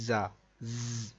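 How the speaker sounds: زا، ز، stretched slowly with a hard buzzing z; drawn-out hard buzz on ز. Shown as a demonstration. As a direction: make the ز in زا، ز، buzz hard like a whistle. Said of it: "اس کو تھوڑا سا سختی سے پڑھنا ہے".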